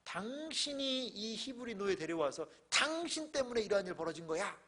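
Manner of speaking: nagging wife. Acted. Potiphar's wife blaming her husband for bringing the slave, nagging. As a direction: lightly act a wife heaping blame. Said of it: "약간 이러한 바가지성이 짙어요".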